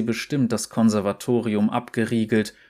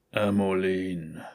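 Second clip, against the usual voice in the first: tief, langsam und fremd gerufen; unheimliche Feuerstimme; 3 semitones lower, slower than usual. Acted. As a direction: lock sie wie ein Geist. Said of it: "Die Stimme wuchs mit den Flammen". (dunkle Geisterstimme)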